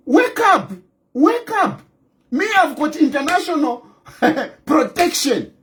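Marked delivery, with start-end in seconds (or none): 0.1-1.8 higher-pitched rousing call. Real, not acted. Speaker tells deluded opponents to wake up, calling out high. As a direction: call out to rouse them.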